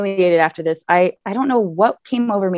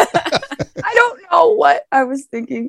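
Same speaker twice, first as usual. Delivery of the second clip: high, slow, laughing squeal; real laughter stretching the words; embarrassed laughing; 11 semitones higher, slower than usual. Real, not acted. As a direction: wail it through helpless laughter. Laughing over own embarrassing story.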